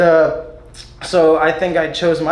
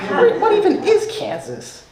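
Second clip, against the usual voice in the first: much higher, laughing and slow; laughing mock disbelief. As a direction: ham up the disbelief through laughter. Mocking people's confusion.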